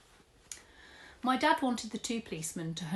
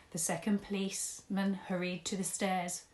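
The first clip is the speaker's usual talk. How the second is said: ordinary delivery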